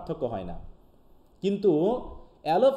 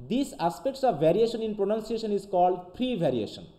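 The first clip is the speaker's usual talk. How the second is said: slow, careful English read-aloud; slow dictation read; 2 semitones higher, much slower than usual. Shown as a demonstration. Teacher reading the definition slowly to students.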